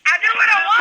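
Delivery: high, loud sign-off cry; cheering the show's catchphrase in unison. Acted. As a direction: shout the show's slogan together. Show sign-off chant.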